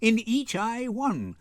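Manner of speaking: normal talking voice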